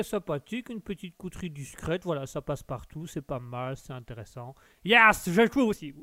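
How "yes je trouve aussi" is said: higher, louder audience member chiming in; voce del pubblico. Acted. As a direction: pipe up loudly as the crowd. Plays the audience answering him.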